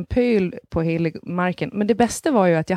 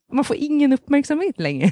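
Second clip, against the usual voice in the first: laughing; 8 semitones higher than usual. Real, not acted.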